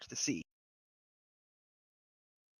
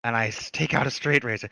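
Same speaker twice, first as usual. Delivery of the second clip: ordinary delivery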